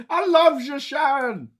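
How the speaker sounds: wobbly mock Irish accent, yelled high; hammed-up Irish-accented declaration; Tom Cruise. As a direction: bellow it in a bad Irish accent. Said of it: "Tom Cruise's wobbly attempt at an Irish accent".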